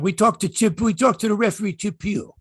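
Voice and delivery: high-pitched Boston accent impression. Co-host imitating a podcaster's recorded insert, Boston accent. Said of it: "He's got a Boston accent".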